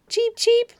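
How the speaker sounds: high bright birdcall cheep cheep; bird cheep. A narrator's reading. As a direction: chirp it like a little bird. Bird begins its story.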